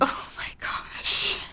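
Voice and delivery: slow, voiceless aside; exasperated whisper. Real, not acted. Reacting to co-host's botched terms, whispered. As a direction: whisper it slowly under your breath.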